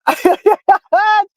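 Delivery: a high burst of real laughter; cracking up at the story. Real, not acted. Laughing at the lady's mistake.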